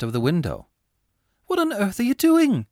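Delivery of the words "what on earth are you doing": much higher, louder, amazed; Laura demands what he is doing, astonished. Acted. Laura sees the cake laid out, astonished.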